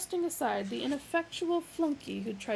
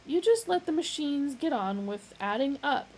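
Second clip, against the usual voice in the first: warning; 6 semitones higher, 5 dB louder than usual. A narrator's reading. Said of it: "warned Magic Thighs".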